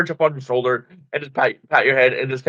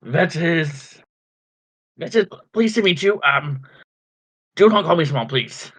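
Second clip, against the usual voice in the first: kobold Vet; 4 semitones higher than usual. Acted. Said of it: "and he's like".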